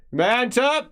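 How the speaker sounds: high, loud call through a closed door; voice from inside. Acted. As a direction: summon him in from the far room. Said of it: "You hear a voice from inside".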